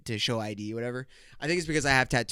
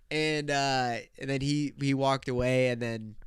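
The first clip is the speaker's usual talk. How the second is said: usual speaking voice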